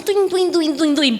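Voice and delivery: high, bouncy cartoon boings; playful imitated boing, repeated; springy "tuing" noises. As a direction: rattle off high springing sound effects. Imitating test points shooting up.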